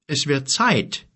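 slow, kindly giant; giant's voice. Acted. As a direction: speak as a fatherly giant. Giant Gork speaks slowly.

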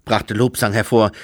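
ordinary delivery, nothing marked

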